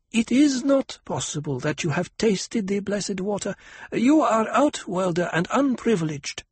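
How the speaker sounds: put-on a girl priestess asking solemnly; young girl voice. A narrator's reading.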